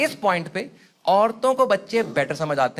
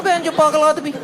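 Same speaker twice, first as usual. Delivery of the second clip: confused man; 5 semitones higher than usual. Retold or imitated. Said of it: "कह रहा".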